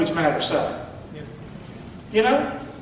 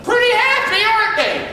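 much higher, bright prompt line; call to repeat; 12 semitones higher, 4 dB louder than usual. Shown as a demonstration. Pastor leading the congregation's response, high and lifted. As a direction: sing it out for them to echo.

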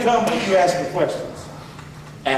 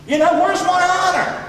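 higher, slow and shouted, God calling them out; God's voice, shouting; 8 semitones higher, slower than usual. Acted. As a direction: boom it out as an offended God. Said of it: "God says".

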